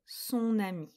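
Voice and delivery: "son ami" with the n sounded into "ami"; liaison. Shown as a demonstration. Teacher corrects the quiz sentence, "son ami" with linked n. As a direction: link "son" to "ami" through an n. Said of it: "on fait bien la liaison".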